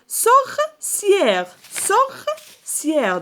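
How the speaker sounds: high, slow sor... cière; syllable-by-syllable. Shown as a demonstration. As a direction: lift and stretch both halves of sorcière. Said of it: "فين كنسمعوا le son A".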